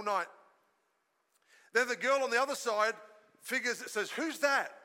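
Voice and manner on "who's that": usual speaking voice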